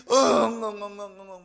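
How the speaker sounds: loud imitated sobbing; mock wailing. Preacher acting out Luther's wife weeping, mock wailing. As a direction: wail "boo-hoo" loudly, acting it out. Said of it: "우는 거예요".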